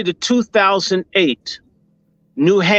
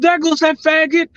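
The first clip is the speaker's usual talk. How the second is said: retold screaming; 10 semitones higher than usual. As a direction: yell it out as the memory comes back, much higher. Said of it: "I started screaming".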